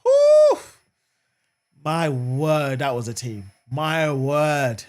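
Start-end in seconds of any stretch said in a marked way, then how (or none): 0.0-0.6 big high breath of relief; loud relieved whew. Real, not acted. Watching the match, exhales.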